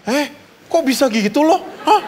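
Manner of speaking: surprised gasp with a laugh; mock shocked gossip. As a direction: gasp as if hearing juicy gossip. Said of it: "kita gini".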